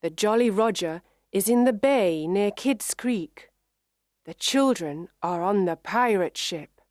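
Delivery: plain reading voice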